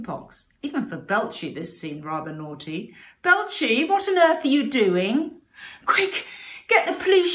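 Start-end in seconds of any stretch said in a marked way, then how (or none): 3.2-5.4 high, loud, startled cry of a mother; startled call. Acted. Mother catching her naughty pig, calling out surprised. 5.9-7.3 high, loud, panicked shout; urgent shout. Acted. Pig trapping robbers, shouting to his mother for help. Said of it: "shouted Belcher".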